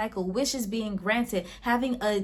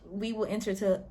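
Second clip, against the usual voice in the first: usual speaking voice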